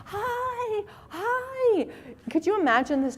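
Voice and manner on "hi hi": very high sing-song hi; high gushing hello; eager greeting. Retold or imitated. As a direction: wave him in with over-the-top delight. Dad greeting the courier.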